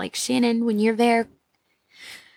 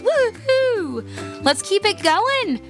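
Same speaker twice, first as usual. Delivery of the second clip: excited cheer; 11 semitones higher, much slower than usual. Real, not acted. Celebrating a hundred downloads.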